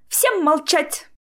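slow, high-pitched command; angry order. A narrator's reading. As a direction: demand silence from everyone, stretching it out. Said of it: "топнул ногой Джоплин". (гневный протяжный приказ высоким голосом)

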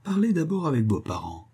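high, lightly smiling advice; knowing old man with a sly smile; subtle smile. A narrator's reading. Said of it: "avec un fin sourire".

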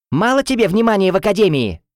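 high, shrill accusation; shrieking woman. A narrator's reading. Woman rival at the heroine's ear, shrieking. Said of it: "взвизгнул мне в ухо женский голос".

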